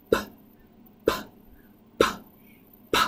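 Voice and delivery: crisp unvoiced "p" with no throat voice; repeated bare "p" sounds. Shown as a demonstration. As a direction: pop the p with air only. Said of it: "You can hear there's no voice happening".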